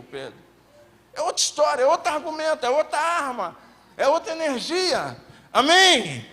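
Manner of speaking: loud, driving sermon climax; impassioned preaching. Acted. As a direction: preach it at full force to the congregation.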